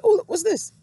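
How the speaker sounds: high-pitched, intrigued "ooh"; curious surprise. Retold or imitated. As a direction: retell a sudden curious discovery, pitch jumping up. Retells spotting the book in a car.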